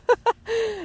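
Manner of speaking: bright giggle; high laugh. Real, not acted. Laughing at prank story.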